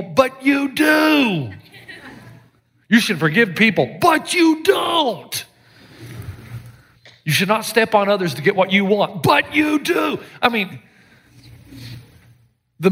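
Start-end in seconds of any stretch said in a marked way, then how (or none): 0.0-1.5 "do" drawn out very slow and shouted; hammered point. Real, not acted. Preacher to congregation, slow emphatic shout. 4.0-5.5 "you" raised high, drawn out slow and shouted; hammered point. Real, not acted. Preacher calling out his congregation, slow emphatic shout. 9.2-10.2 "you" raised high, drawn out slow and shouted; hammered point. Real, not acted. Preacher calling out his congregation, slow emphatic shout.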